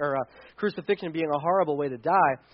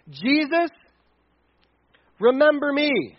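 higher-pitched, bold appeal with a pause; gutsy request; 7 semitones higher than usual. Retold or imitated. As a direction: call on him boldly, pitched up. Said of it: "That is gutsy".

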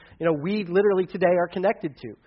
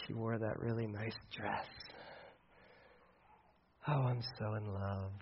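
much softer, lower swooning voice; dreamy lovestruck husband; 5 semitones lower, 9 dB quieter than usual. Acted. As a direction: sigh it out, dreamily in love. Husband voiced gushing over dinner.

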